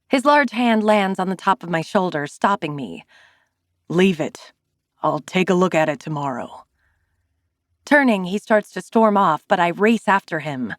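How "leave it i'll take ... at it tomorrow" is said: a hint of a gruff man cutting her off; low, terse brush-off; lightly voiced curt man. Zane curtly refusing her help, lightly voiced.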